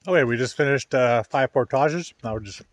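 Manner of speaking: talking through a mouthful of crackers; eating dry crackers. Real, not acted. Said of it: "sorry, mouthful of crackers".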